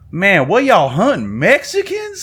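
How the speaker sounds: deadpan setup, then swing the last word way up; buddy's joke voice. Retold or imitated. Joking to the sales team about a hunting photo, slow and high-pitched.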